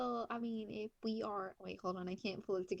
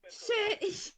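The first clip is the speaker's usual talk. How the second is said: laughing; 12 semitones higher, 6 dB louder, slower than usual. Real, not acted. Outburst then laughter.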